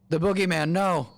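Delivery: playful fake terror at the boogeyman; mock fright. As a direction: yelp it like a spooked kid in a game. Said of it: "Ariel's scaring me with boogeyman noises".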